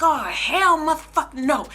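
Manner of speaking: high, loud enraged yelling; furious outburst. Retold or imitated. Mother told someone touched her child, yelling furiously. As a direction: explode with a mother's rage.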